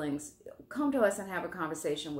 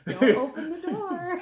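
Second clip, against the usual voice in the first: mock gentle; 10 semitones higher, 6 dB louder than usual. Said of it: "joining the mock gentle voice".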